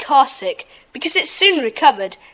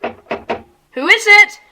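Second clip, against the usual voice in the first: slow, higher, louder call to the door; calling through the door; 6 semitones higher, 4 dB louder, much slower than usual. Acted. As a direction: call out warily to the knock. Sudden knock at back door.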